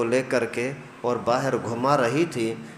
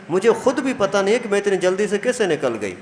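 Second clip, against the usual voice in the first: ordinary delivery, nothing marked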